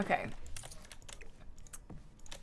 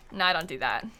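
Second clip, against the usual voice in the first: normal talking voice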